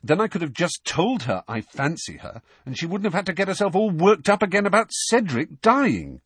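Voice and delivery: high-pitched, frustrated argument; heated, emphatic complaint; forcefully. A narrator's reading. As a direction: push the point, rising in exasperation. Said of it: "said Harry forcefully".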